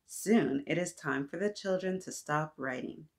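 ordinary delivery, nothing marked

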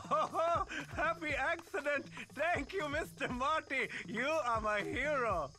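high, gushing gratitude with a laugh; overjoyed, high-pitched praise; delighted thanks. Acted. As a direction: gush at your hero, slowing on the last words. King thanks his rescuer with delight.

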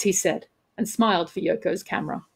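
ordinary delivery

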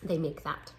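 plain reading voice

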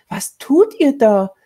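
high, loud, drawn-out cry of horror; horrified shout. A narrator's reading. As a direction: call out aghast. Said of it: "rief Mary entsetzt". (entsetzt gerufen)

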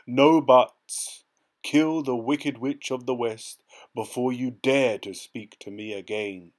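loud, forceful order; a commanding wizard voice; stern command. A narrator's reading. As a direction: bark it like a booming ruler. Oz ordering them away.

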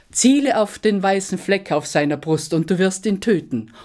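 high, loud urgent pleading; pleading dragon. A narrator's reading. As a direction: convince him fast, voice pitched high. (hoch und laut flehend)

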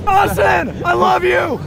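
high, frightened call to a friend; panicked shout. Real, not acted. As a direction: cry it out as last words. Frightened farewell shouted on ride.

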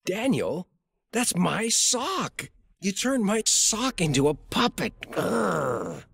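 high-pitched, upset parental protest; exasperated. Acted. Dad finding his sock made a puppet, exasperated. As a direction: rise in dismay, ending in a growl.

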